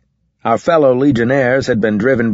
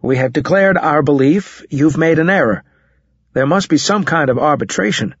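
controlled anger; 7 semitones higher than usual. A narrator's reading. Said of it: "trying to control my temper".

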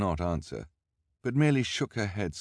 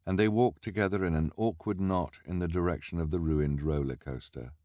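ordinary delivery, nothing marked

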